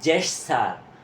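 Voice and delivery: "জেটসার" with j sound, jesh-sar; correct gesture. Shown as a demonstration. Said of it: "এটা হবে".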